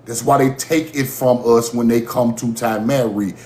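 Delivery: ordinary talk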